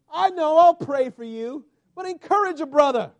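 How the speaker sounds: loud, high preaching push; full-force preaching. Acted. Pastor pleading with church to encourage him, loud preaching. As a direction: preach it hard at the congregation.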